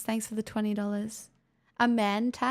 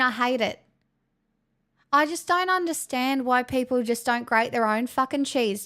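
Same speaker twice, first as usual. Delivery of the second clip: broad, hammed-up Australian accent. Shown as a demonstration. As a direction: rant in thick mock-earnest Aussie. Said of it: "this is my really natural Australian accent".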